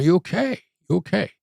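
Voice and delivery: puzzled, caring check-in; calm hostage negotiator. Retold or imitated. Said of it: "a little confused and probably a little curious".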